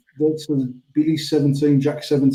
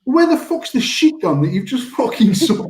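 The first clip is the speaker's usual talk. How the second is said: mock disgusted disbelief; 10 semitones higher than usual. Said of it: "And I was like".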